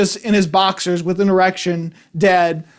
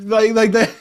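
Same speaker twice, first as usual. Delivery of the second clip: muffled moan imitation. Reviewer imitating the gagged man heard through hotel walls, muffled moan. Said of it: "Sorry for making that weird thing".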